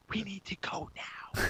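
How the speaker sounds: slow, hushed whisper; urgent whisper. Retold or imitated. Frightened friends to the angry guy, urgent whisper. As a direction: whisper it as an imitated warning.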